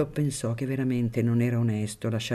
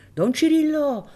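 high, loud call up to the window; 7 dB louder than usual. Acted. Filippino calls up to Don Cirillo, high and loud. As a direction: call his name from the street.

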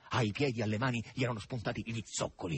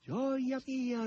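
high, joyful cry to her mistress; noisy whooping. A narrator's reading. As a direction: squawk it out high with delight. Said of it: "ridestando con i suoi schiamazzi".

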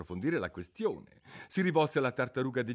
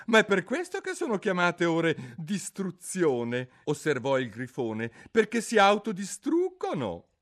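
impatient griffin, higher and louder; gruff griffin; 8 semitones higher, 7 dB louder than usual. Acted. Griffin impatiently urging the turtle to get on with it.

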